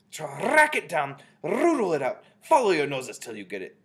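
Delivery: loud, high orders with trilled R; screamed. A narrator's reading. Grand High Witch sending witches to follow their noses, screamed. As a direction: scream each order, rolling the R long. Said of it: "screamed the Grand High Witch".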